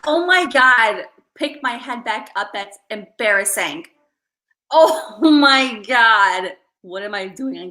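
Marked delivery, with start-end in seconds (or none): 0.1-1.1 slow, loud, aghast. Real, not acted. Vlogger embarrassed on camera, slow loud aghast. After the scream. 4.7-6.5 very slow, loud, laughing through it. Real, not acted. Vlogger laughing at her own embarrassment on camera. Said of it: "I'm laughing".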